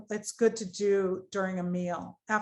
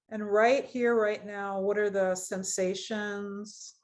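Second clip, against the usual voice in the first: guided meditation. Acted. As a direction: ease them gently into the body scan. Leads a guided body scan.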